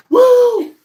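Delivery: a high, loud woo; high-pitched excited whoop; hype shout. Shown as a demonstration. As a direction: let out a hyped-up whoop. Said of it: "talk like this".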